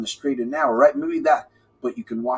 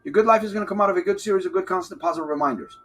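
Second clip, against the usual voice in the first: rushed and quick; fast; 3 semitones higher, faster than usual. Shown as a demonstration. Coach replaying his motto for viewers, very fast. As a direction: rattle it off fast like a rewind. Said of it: "go faster now".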